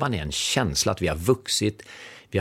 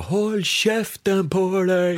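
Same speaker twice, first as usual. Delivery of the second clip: high, very slow mock yell of the angry guy; retold shout; 10 semitones higher, much slower than usual. Colleague at McDonalds meeting yelling at the consultant, hammed-up shout. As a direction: stretch it out like an imitated outburst. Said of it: "skriker bara".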